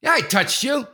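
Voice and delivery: high, loud and defiant; defiant retort. Retold or imitated. Dad defies the man who confronts him, loud. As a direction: own it, loud and unrepentant.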